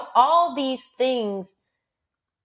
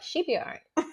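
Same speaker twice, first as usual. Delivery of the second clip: relaxed mom; 6 dB quieter than usual. Retold or imitated. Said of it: "you're like".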